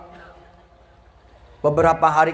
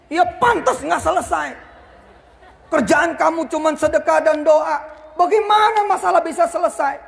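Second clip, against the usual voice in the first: higher, scoffing retort with a laugh; mocking disbelief; 9 semitones higher than usual. Creditor scoffing at the debtor's answer, mocking laugh. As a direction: scoff at the silly excuse.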